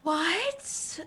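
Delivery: high startled what; surprised what. Real, not acted. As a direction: jolt of shock. Player shocked artifact here.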